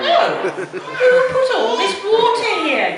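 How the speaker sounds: high, loud, laughing exclamation; surprised Daddy Pig. Acted. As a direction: cry out in comic astonishment at the flood.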